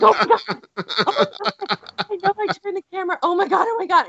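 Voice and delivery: high, fast panicked gasping; reliving the camera scare in a high voice; retold panic. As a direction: spiral into a startled fright. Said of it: "freaking out".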